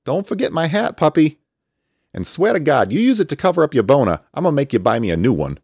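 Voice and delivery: high, loud teasing call, speeding up; loud playful taunt; calling over shoulder. A narrator's reading. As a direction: shout back while flying off. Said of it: "calling over his shoulder".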